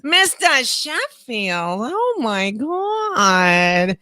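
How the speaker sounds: very slow, nasal whining impression; Fran. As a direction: drag each word out through the nose. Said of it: "there's Fran".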